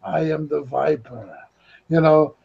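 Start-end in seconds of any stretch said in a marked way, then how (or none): 0.0-1.4 slow, low and a touch softer villain voice; villain again. Retold or imitated. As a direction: purr the name like a cartoon baddie. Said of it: "And he's like".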